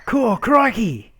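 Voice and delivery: loud, high, drawn-out Aussie "crikey"; mock Australian catchphrase. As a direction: imitate tourists hamming up Australian slang. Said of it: "every other person would come up".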